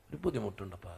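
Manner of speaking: a son meekly admitting trouble; timid son. Retold or imitated. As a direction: barely get it out to dad. Son confides to father.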